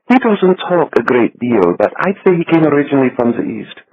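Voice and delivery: little Swiss banker. A narrator's reading. Becker describes Wolf.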